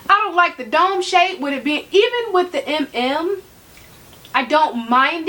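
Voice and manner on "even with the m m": usual speaking voice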